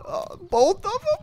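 tiny, very high, slow whimper; mock whine. Player told to remove their signs, mock whimpering. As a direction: plead in a squeaky fake-sad voice.

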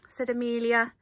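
plain reading voice

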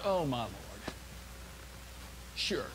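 low, muted, dismissive aside; weary scoff. Acted. Doubter answering the angel of the Lord, weary scoff. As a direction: mutter it back, unimpressed.

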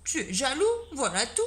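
put-on a confident little girl answering back; Annabelle retorting. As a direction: put the jealous boy in his place. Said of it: "répondit Annabelle".

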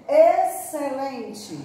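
"excelente" bem devagar, X como S; slow excelente. Shown as a demonstration. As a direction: alongue "excelente" com som de S. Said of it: "o X com o som de S". ("excelente" lento, X soando S)

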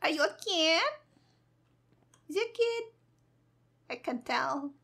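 normal talking voice

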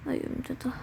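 whispered, worn-out sigh; tired whisper. Acted. Tired student mutters after cleaning, whispered sigh. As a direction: breathe it out like you're spent.

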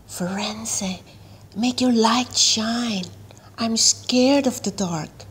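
scared whisper in the dark. A narrator's reading. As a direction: whisper it so no one else at the sleepover hears. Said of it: "Natalie whispered".

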